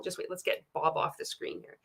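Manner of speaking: a quick murmured aside to herself; whispered aside. Real, not acted. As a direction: whisper it off-mic while fiddling with the screen. Aside while fixing screen.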